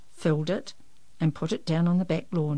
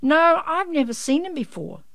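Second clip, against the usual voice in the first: high, projected call to a neighbour; calling back; 10 semitones higher, 5 dB louder than usual. A narrator's reading. Janey answers Missus Frogmore from a distance, calling back. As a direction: call it back across the yard, pitched up. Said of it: "Janey called back".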